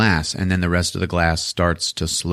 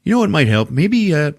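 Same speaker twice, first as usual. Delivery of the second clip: higher-pitched, put-on doctor voice; lightly voiced doctor, pitched up and tentative; Doctor Foley; 10 semitones higher than usual. Doctor suggesting hypnosis to his patient, lightly put-on voice. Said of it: "Doctor Foley's like".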